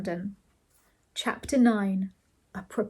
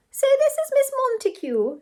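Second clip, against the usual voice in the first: very high, louder society-lady voice; 5 dB louder than usual. A narrator's reading. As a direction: pipe up in a very high register. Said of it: "in a rather high voice".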